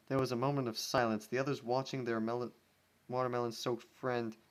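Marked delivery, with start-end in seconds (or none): none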